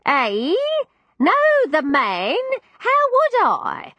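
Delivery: put-on high, slow old woman, puzzled; a deaf old lady, bewildered; old landlady voice.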